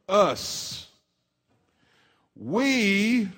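slow, deliberate preaching read; scripture reading. Acted. Pastor reading Second Corinthians to the church, slow solemn read. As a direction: lift the verses like a proclamation.

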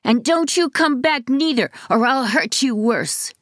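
high defiant call-out threat; calling after. A narrator's reading. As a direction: shout it after them as they leave. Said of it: "Devon called after them".

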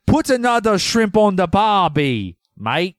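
high, badly faked Australian accent; attempted Australian accent. Shown as a demonstration. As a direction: have a hammed-up go at sounding Australian. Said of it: "let me try".

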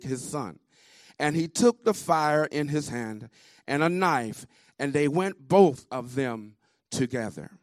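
loud, slow preacher's scripture reading; slow, energetic projection. Real, not acted. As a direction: project each phrase with weight, pausing between. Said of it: "slowly with energy and project".

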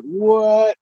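high, punchy stunned what; shocked what. Retold or imitated. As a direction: blurt it out in disbelief. Caught off guard by booking.

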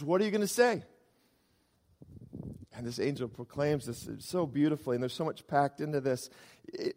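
ordinary delivery

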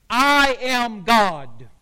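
slow, loud, stressed I AM GOD; emphatic and unfaltering, at half pace; emphatic declaration. Retold or imitated. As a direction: declare it with no hesitation, each word drawn out. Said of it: "Jesus is saying very emphatically".

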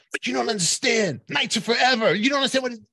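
high, loud pleading fan insisting; frantic fan shouting her devotion; obsessed fan. Retold or imitated. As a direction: play the desperate fan who just doesn't get over it. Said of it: "and it goes".

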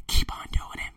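hushed repeat of keep on doing it; whisper. Shown as a demonstration. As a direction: whisper it like a secret dare. Taunting his doubters.

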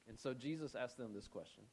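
normal talking voice

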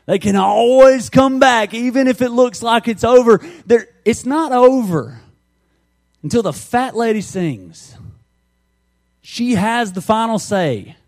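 loud, high-pitched rallying cry; rousing preacher shout. Real, not acted. Preacher rallying Georgia fans about comebacks, loud and rousing. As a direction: belt it out to rouse the crowd.

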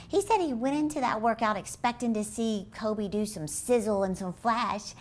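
ordinary delivery

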